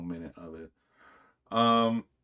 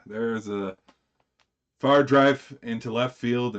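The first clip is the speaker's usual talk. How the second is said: louder, drawn-out announcer call, x0.7; mock play-by-play call; 3 semitones higher, 8 dB louder than usual. Streamer hams up a baseball call for viewers, announcer voice.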